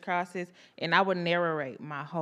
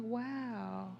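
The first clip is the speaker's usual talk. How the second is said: hushed awed wow; 2 semitones higher, 13 dB quieter than usual. Retold or imitated. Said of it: "And I'm like".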